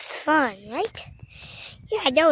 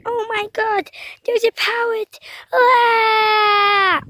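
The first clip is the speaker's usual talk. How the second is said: scared scream; 5 semitones higher, slower than usual. Pretend. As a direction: shriek at the parrot. Parrot appears, toy screams.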